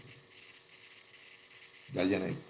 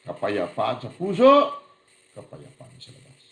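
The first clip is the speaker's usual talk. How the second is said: normal talking voice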